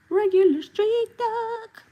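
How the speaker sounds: high squeaky cartoon duck voice, slow. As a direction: squeak it out like a silly street duck.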